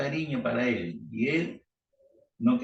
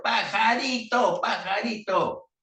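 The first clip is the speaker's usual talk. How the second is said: yelled in his ear; 9 semitones higher, slower than usual. Acted. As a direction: shout each word into his ear. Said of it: "se lo grita así en el oído".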